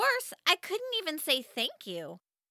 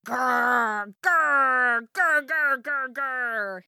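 put-on loud growling animal noises from a little boy; howling growls; 6 dB louder, much slower than usual. Little brother Max pestering his sister with loud bear growls. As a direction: growl like a bear cub to annoy her. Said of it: "he howled".

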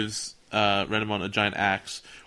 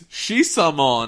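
"Shisamon" loud and drawn out; mock-grand "Shisamon", slow and loud; hammed-up name reveal; 4 semitones higher, 5 dB louder, much slower than usual. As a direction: stretch out "Shisamon" like a silly announcement. Mocks the dub's renamed guardian.

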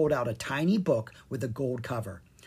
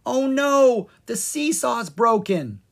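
loud, high, hammed-up cry of alarm; a loud pretend panic; fake alarm yell; 10 semitones higher, 7 dB louder, slower than usual. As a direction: yell it to fool everyone, slowly. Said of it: "Then she yelled".

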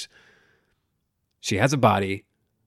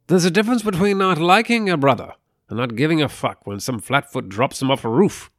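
movie character voice; 6 semitones higher, 7 dB louder than usual. Retold or imitated. Quoting film line in voice.

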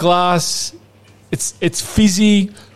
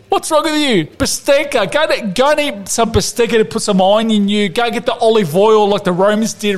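higher, hammed-up Italian-flavoured tirade; mock outraged rant; 5 semitones higher than usual. Podcast host ranting at Ramsey, hammed-up outrage. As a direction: rant at him like an exasperated nonna.

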